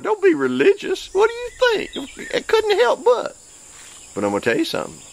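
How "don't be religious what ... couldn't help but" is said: jumps way up high in a laughing protest; high-pitched playful indignation; squeaky excited protest. Real, not acted. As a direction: defend yourself with a giddy squeal. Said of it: "laughing self-defence, voice shooting up".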